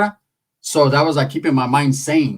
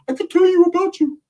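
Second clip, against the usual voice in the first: much higher mock-mystic voice; spooky psychic. Ricky claiming psychic powers, high and eerie. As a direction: play the creepy mind reader. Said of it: "He's like".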